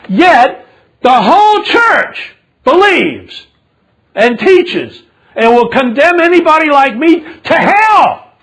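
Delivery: loud, high indignant sermon; full-force preaching. Real, not acted. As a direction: preach it out with outrage, pitch soaring on key words.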